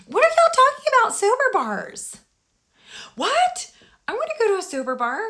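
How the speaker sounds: reacting to chat about sober bars; excited. Real, not acted.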